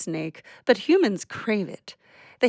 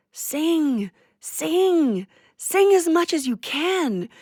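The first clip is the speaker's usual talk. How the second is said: poetic exclamation; 8 semitones higher, slower than usual. Acted. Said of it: "You're especially poetic today".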